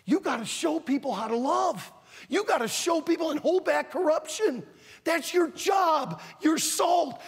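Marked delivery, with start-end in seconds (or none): 2.3-7.2 fiery sermon exhortation; exhorting shout. Real, not acted. Preacher exhorts teenagers.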